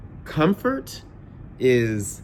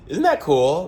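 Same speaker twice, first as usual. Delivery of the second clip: laughing, high and loud, thrilled; laughing excitement; 7 semitones higher, 6 dB louder than usual. Real, not acted. Vlogger laughs to viewers about being in Bangkok, excited.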